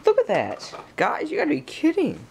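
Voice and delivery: high disbelieving surprise; can't believe it, voice shoots up; surprised. Real, not acted. Reacting to a broken CD.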